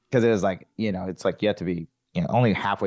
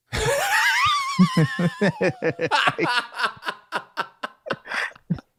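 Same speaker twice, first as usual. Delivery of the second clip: big laugh. Real, not acted. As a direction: burst out laughing at the punchline. Laughing at own punchline.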